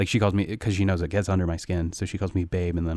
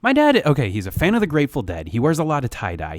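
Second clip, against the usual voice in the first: excited; 6 semitones higher, 3 dB louder than usual. Real, not acted. Excited start of dad story.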